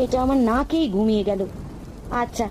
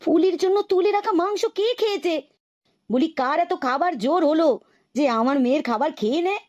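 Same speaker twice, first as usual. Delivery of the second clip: high-pitched, indignant outcry; mother's high, scandalised complaint; upset outcry; 5 semitones higher than usual. Acted. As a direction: cry out in alarm, demanding the culprit. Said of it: "এত চেঁচামেচি করছো কেন".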